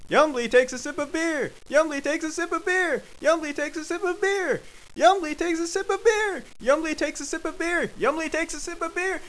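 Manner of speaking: very high, insistent narration; frantic escalation. A narrator's reading. Narrator piles up Yumbly's sips, frantic and high. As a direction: keep hammering it out at the top of the voice.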